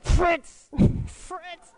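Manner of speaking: very high, loud mock call of Fritz, breaking into a laugh; calling the dog all day. Imitating the priest calling all day, high and laughing. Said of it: "it would just be all day like".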